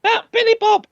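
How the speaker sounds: ordinary talk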